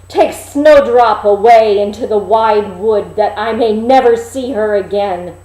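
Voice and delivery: loud furious order to a servant; enraged command. Acted. Envious queen orders her servant, loud and furious. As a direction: bark it out in jealous rage. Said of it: "she turned pale with rage and envy".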